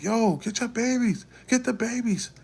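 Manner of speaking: high loud urgent yelling; urgent shout. Retold or imitated. Shouting at the frozen mom to get her babies, loud urgent call. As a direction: yell at her to grab her kids. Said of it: "I'm telling her".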